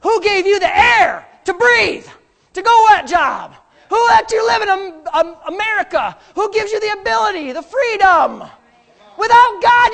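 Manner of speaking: high, loud thundering rebuke; preaching shout. Real, not acted. Pastor rebuking money-lovers at full force. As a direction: thunder it at the congregation.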